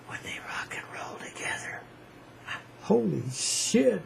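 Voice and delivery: hushed, breathless whisper of awe; awed whisper. Acted. Hushed awe over rock and roll.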